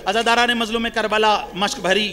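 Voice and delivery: ordinary talk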